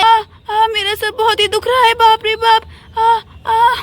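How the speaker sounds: pained moaning. Acted. Mamta with a hurt head, moaning and crying out. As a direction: moan with a hurting head.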